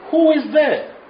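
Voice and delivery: high, loud challenge from inside; challenging gatekeeper. Retold or imitated. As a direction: call out as the voice behind the door. Acts temple voice challenging the knocker.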